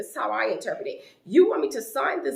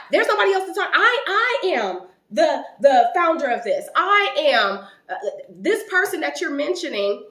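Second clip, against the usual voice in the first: louder, higher, indignant mimicry of a self-important founder; indignant retort; 5 semitones higher, 7 dB louder than usual. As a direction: imitate her loud, affronted insistence on rank. Retells executive's indignant outburst.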